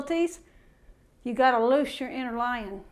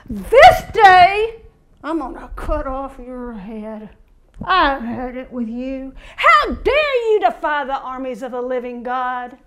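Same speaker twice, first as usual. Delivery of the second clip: a hint of David's furious defiance; lightly voiced bold warrior threat; defiant challenge; 6 dB louder than usual. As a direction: hurl the challenge at the giant. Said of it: "What did he do?".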